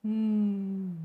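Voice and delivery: loud "mm" for N, through the nose; "mm" hummed through the nose, tongue up; nasal n sound. Shown as a demonstration. Teacher showing the nasal N sound. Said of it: "The sound comes from your nose".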